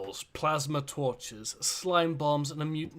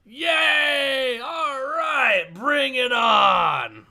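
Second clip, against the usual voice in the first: loud, slow shouted cheer; crowd cheer; 10 semitones higher, 9 dB louder, much slower than usual. Acted. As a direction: roar like a hyped crowd. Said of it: "do the voice of the crowd".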